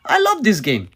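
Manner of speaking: loud, high gloating cry; hyped gloat. Real, not acted. Fan crowing over the beaten rival, loud and high.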